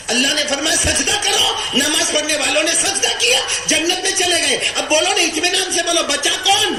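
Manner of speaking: very high, insistent sermon voice; full-force preaching. Acted. Preacher quizzing the crowd on who remains, high impassioned preaching. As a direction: push every phrase up high. Preaches Judgement Day scene.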